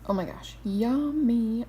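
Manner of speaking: loud, high yummy; delighted. Real, not acted. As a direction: savour it with delight. Tasting her congee.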